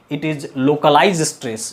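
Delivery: drawn out word by word; dictating a definition to the class; slow dictation. Shown as a demonstration. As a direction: spell it out slowly for notes.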